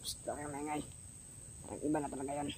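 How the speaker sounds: hushed, very soft warning; whispering while hiding. Acted. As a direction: hiss it quietly so nobody hears. Hushing companion, hiding.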